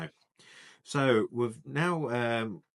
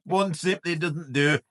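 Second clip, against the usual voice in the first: mock Scottish accent; 4 semitones higher, 7 dB louder than usual. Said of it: "you was literally like".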